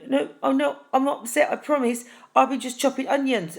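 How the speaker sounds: high, rushed protesting no's; flustered denial. Acted. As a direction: insist you're fine while caught crying.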